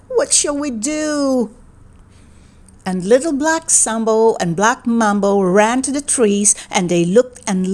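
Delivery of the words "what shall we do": slow, higher cry of dismay. A narrator's reading. Boy asks his mother for help, crying out in dismay. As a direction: draw it out, mildly distraught. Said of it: "he cried".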